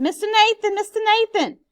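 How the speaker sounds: high, loud warning shouts; shouting. Acted. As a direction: pound out the alarm to the neighbors. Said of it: "and she shouted".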